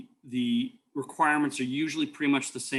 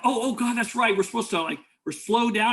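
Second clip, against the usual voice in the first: higher, flustered and caught out; mock-panicked stammer, caught red-handed; 9 semitones higher, 4 dB louder than usual. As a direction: rookie driver flustered after blowing a red light. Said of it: "And I'm like".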